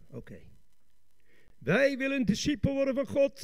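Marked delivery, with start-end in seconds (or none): 1.6-3.4 high, fervent preacher's proclamation; rousing declaration. Shown as a demonstration. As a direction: declare it fervently to the whole congregation. Rousing take after the subdued one. (vurig verkondigend)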